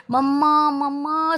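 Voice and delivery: calling out. Acted. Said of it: "सब जगह चिल्लाता है".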